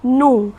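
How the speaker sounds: नो, k not sounded; just नो, no k; silent k. Shown as a demonstration. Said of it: "के letter है वो silent होता है". (के silent, नो)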